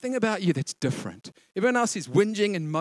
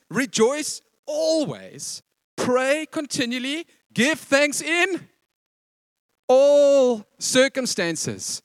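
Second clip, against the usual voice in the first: slow, high, weighty verse reading; preacher reads the verse to the church, slowly; slow proclamation; 7 semitones higher, 5 dB louder, much slower than usual. Acted. Very slow, emphatic scripture proclamation.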